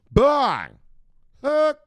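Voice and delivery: high, loud hype yell; excited shout. Real, not acted. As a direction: yell it like the market just exploded. Hyping the crypto run-up.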